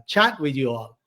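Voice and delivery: ordinary talk, nothing marked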